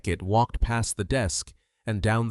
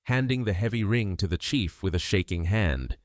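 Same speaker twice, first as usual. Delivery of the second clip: ordinary delivery, nothing marked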